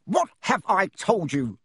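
mild thunder. Acted. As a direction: uncle thunders across the table. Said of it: "thundered his uncle".

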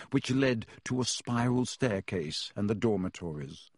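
ordinary delivery, nothing marked

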